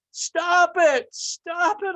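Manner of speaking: high, loud cry, slowing on the second; the teacup crying out against the paint fumes. Acted. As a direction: beg him to stop, desperate. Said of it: "I cried".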